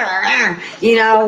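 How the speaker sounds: usual speaking voice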